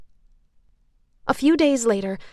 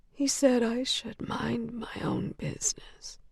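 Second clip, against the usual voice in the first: slow, unvoiced whisper; 2 semitones higher, 8 dB quieter, slower than usual. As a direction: murmur it fearfully, slowly. Whispering patient.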